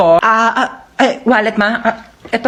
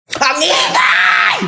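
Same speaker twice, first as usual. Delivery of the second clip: put-on whiny woken child; 5 semitones higher, much slower than usual.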